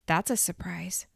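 whispered, dry sarcastic reply. A narrator's reading. Captive answers her knife-wielding captor in a sarcastic whisper. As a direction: breathe the words out with sarcasm.

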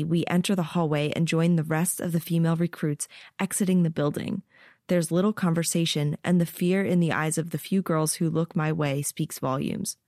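usual speaking voice

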